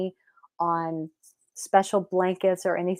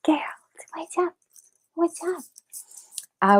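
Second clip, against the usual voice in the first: high-pitched cooing to pets; sweet, squeaky-high pet greeting; pet talk; 9 semitones higher, much slower than usual. Real, not acted. Greeting the animals.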